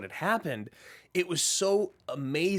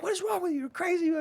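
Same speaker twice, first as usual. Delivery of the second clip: much higher, shocked accusation; shrill alarm; faster than usual. Retold or imitated. Nurse shocked when pushed, high alarm. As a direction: cry it out in disbelief. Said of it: "she's like".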